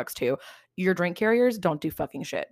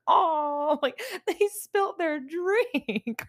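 high, slow, laughing mock sorrow; mock pitying wail; 11 semitones higher, much slower than usual. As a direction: whimper over a spilled drink in jest. Sobbing at movie spills.

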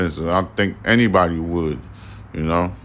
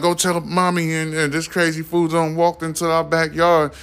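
put-on higher tattling kid voice; lightly voiced girl; 9 semitones higher than usual. Girl calling for mommy, lightly higher tattling voice. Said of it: "the girl's like".

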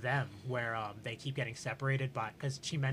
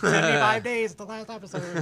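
mock announcement; 8 semitones higher, 8 dB louder than usual. Mimicking the subreddit countdown.

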